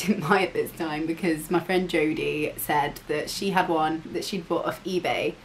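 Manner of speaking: ordinary talk, nothing marked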